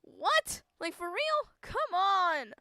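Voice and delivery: squeaky high what, for real; can't believe it, climbing up; high-pitched startled protest; shocked disbelief. Real, not acted. Player reacts to Colonel walking home.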